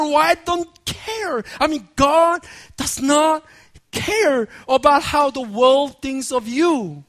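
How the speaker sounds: ordinary talk, nothing marked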